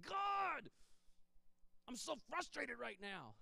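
a high cry out, then slow and worn out; a high cry on God, then drained and slow; frustrated cry to God. Retold or imitated. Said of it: "and just say".

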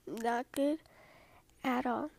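plain reading voice

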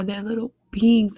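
ordinary talk, nothing marked

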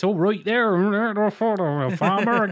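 high, loud West Country farmer accent; exaggerated English farmer accent. Shown as a demonstration. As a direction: ham up a garbled rustic farmer accent. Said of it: "all the farmer accents".

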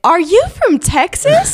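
high, drawn-out question from a stranger; curious stranger. Retold or imitated. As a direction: imitate an astonished passer-by, slowly. Acting out strangers who stopped her.

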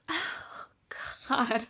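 soft, whispered tearful gasp; hushed whisper while really crying. Real, not acted. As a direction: breathe it out, overcome with tears. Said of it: "crazy crying".

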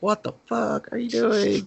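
high-pitched imitated protest; mock alarm. Boy hiding under podium as she grabs his arm, mock alarmed. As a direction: pitch it way up, comically appalled.

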